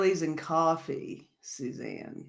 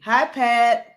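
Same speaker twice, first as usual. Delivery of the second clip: usual speaking voice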